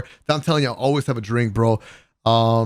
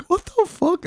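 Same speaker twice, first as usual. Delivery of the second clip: incredulous; slower than usual. Retold or imitated. Retold reaction to cologne.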